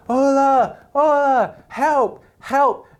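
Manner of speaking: high, loud calls for help; shouting to riders on horseback, high desperate calls; calling for help. Retold or imitated. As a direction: hail them from afar, desperate.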